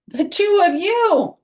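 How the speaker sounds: high, loud, slow fond exclaim; fond exclamation. Acted. Daddy exclaiming at the twins, high and loud with fond exasperation. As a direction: burst out in loving exasperation.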